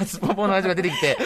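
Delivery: laughing correction. Real, not acted. Correcting すっぽん to すっぽんぽん for the hosts, laughing. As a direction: fix the word to すっぽんぽん while giggling.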